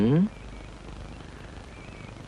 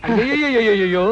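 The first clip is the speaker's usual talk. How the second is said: mock groan; slower than usual. Faking injury to driver.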